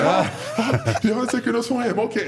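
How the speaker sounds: loud, high, fast fanboy gush; laughing, thrilled disbelief; starstruck excitement. Retold or imitated. Wrestler reliving The Undertaker knowing him, giddy excitement. Retold starstruck inner reaction.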